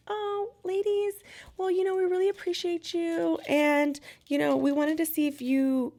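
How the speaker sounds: butter the tourists up before asking money; sugary showgirl. Retold or imitated. Showgirls asking the ladies for a tip, syrupy high voice.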